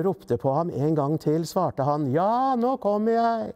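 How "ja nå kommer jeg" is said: high, held-out answering call; calling back. A narrator's reading. Lillebror answers the others calling him, high calling out. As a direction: call back towards the house. Boy answers family's shouts from afar.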